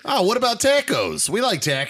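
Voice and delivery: high-pitched, drawn-out Wisconsin vowels; mock Wisconsin accent on "tacos". Co-host joining in on the Wisconsin bit, in Wisconsin accent. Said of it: "Or your Wisconsin".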